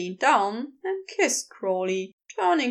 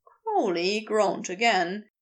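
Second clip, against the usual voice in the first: ordinary delivery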